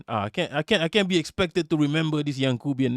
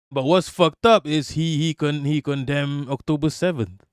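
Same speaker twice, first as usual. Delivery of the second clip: normal talking voice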